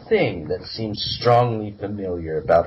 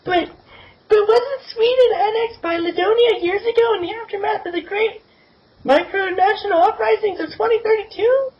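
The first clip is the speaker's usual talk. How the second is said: put-on very high, squeaky girl voice. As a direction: pitch way up for a young girl. Blonde girl voiced by narrator.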